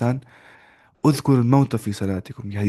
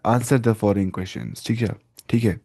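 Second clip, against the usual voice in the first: normal talking voice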